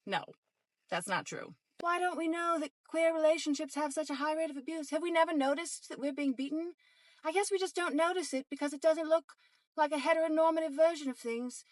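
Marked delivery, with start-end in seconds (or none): 1.8-11.6 higher mock British accent. To the viewers, mocking the myth in a British accent. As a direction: slip into a put-on British accent, sarcastic. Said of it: "I don't know why I'm doing a British accent".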